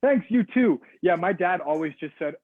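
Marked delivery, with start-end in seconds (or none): 0.0-0.8 high, louder reply; polite customer. Acted. Customer answering the drive-through server, high polite sing-out. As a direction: call it back brightly to the server.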